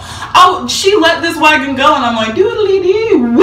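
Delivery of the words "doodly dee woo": gleeful woo. Retold or imitated. Baby Mia riding downhill.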